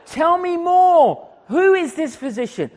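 high, loud imitated demand; eager demand. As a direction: shout it insistently, drawing out the first words. Man following him, demanding to know.

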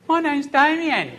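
high mock self-introduction; hammed-up introduction. Preacher imagining being called Damien, hammed-up and high. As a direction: put on a silly high voice.

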